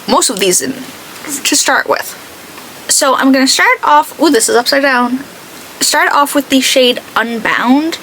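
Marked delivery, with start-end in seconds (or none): none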